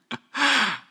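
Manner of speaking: loud high burst of laughter; embarrassed amused laugh; real laugh. Real, not acted. Laughing at his own story.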